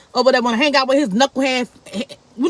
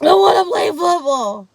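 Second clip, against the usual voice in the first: higher, louder crying wail; crying plea; 6 semitones higher, 5 dB louder than usual. Retold or imitated. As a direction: blurt it out through sobs. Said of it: "He's crying".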